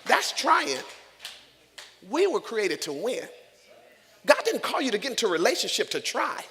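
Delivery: loud, high, driving sermon cadence; preaching at full force. Real, not acted. Preacher drives the church toward the cross, shouting. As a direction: preach it to the rafters.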